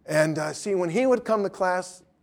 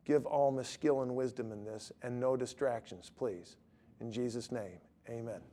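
low, hushed prayer voice; quiet closing prayer; 6 semitones lower, 14 dB quieter than usual. Real, not acted. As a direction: pray softly and earnestly, dropping at each phrase end. Closes a prayer.

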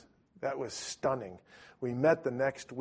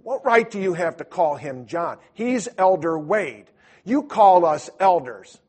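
louder, slower, higher rebuke; indignant scolding; 4 semitones higher, 9 dB louder than usual. Retold or imitated. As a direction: put him in his place, mock-offended. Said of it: "his companion goes".

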